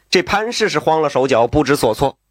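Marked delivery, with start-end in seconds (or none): none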